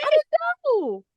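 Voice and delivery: very high, slow, exaggerated protest; drawn-out squeaky protest. Real, not acted. Nanny insisting on her innocence, drawn-out high squeal. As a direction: stretch it out in a squeal of self-defence. Repeats the defence, more exaggerated.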